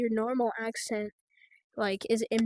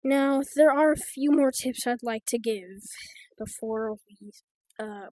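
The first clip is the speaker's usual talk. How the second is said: ordinary talk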